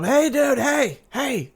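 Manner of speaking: high-pitched call without real volume; mock yelling. Fan re-enacting calling to the roadie crew, high mock yell. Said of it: "I'm, like, yelling at him".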